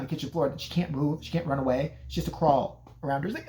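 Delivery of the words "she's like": ordinary talk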